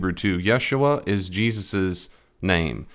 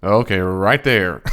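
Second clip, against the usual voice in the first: usual speaking voice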